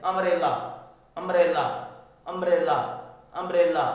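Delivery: अम्ब्रेला loud, opening on a short "uh"; example word drill. Shown as a demonstration. Teacher giving an example of the U sound, loud अम्ब्रेला. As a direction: repeat अम्ब्रेला firmly as separate drill words. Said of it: "जैसे".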